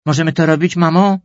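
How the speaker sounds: wysoka, głośna, błagalna prośba; z zapałem. Acted. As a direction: zapytaj mamę, jakby od tego wszystko zależało. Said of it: "spytała z zapałem". (córka z zapałem prosi mamę o zgodę)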